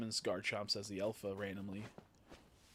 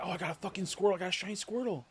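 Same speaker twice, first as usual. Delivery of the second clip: mock excited; 8 semitones higher, 5 dB louder, faster than usual. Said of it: "I should be like".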